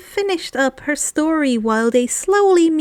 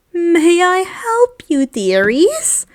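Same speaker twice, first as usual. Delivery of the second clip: put-on slow, sweet old shop lady; slow old-lady voice with a smile; older shopkeeper woman; 5 semitones higher, 3 dB louder, slower than usual. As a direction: greet them like a kindly old shopkeeper. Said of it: "an older woman asked with a smile".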